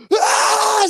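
sudden high, loud scream; imitated scream. Imitating a friend snapping at a game, screaming. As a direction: explode out of nowhere in a mock-rage shriek. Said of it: "like, starts screaming".

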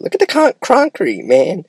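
put-on high, eager kid pointing at concrete; excited kid voice.

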